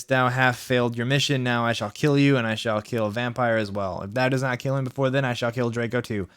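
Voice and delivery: usual speaking voice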